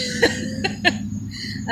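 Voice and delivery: soft, breathy high laugh; quiet little laugh to soften the moment; light airy chuckle. Real, not acted. Laughs after moving moment.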